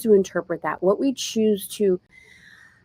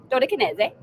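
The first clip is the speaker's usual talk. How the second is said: higher, playful attempt at a French accent; mock French accent; 4 semitones higher, faster than usual. Host jokes the name could be French, mock French accent. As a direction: try it with a French accent, amused. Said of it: "or French".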